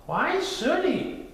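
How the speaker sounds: high, slow storybook mother; high-pitched, unhurried bear mother; Mother Bear character voice. A narrator's reading.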